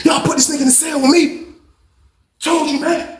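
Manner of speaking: high, whining mock complaint; exasperated high-pitched re-enactment; retold indignant protest. Retold complaint to guards after fight.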